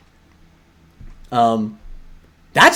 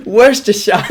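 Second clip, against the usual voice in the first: mock reading voice; 6 semitones higher, 5 dB louder than usual. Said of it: "I sound like a military sergeant".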